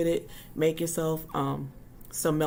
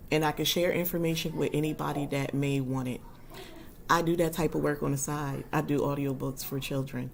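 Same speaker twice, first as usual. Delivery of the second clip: ordinary talk, nothing marked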